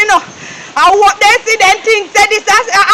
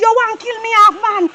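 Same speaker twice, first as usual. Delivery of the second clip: usual speaking voice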